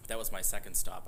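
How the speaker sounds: usual speaking voice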